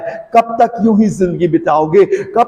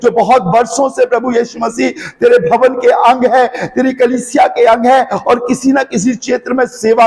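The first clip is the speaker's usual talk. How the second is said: prayer building into an urgent, higher-pitched plea, easing at the close; fervent prayer; 4 semitones higher than usual. Real, not acted. Preacher praying over his listeners for freedom and healing, fervent prayer. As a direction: pray in a pressing, unbroken stream of petitions.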